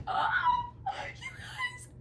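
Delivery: gasp, then a very high laughing squeal. Real, not acted. Fan squealing with laughter over the couple. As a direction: squeal with delight at the scene. Reacting to favourite show scene.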